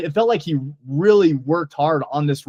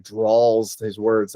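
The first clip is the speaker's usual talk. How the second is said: drawl demo; 5 semitones lower, much slower than usual. Shown as a demonstration. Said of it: "He just kind of".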